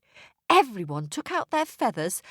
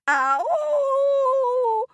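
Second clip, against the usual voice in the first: sudden high wail of pain; 7 dB louder than usual. Acted. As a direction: cry it out, high and loud. Trapped people wail in dark.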